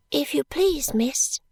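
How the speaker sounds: put-on a shy child speaking carefully; soft little girl. A narrator's reading. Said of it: "in the same soft voice".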